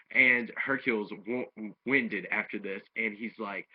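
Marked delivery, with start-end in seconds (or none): none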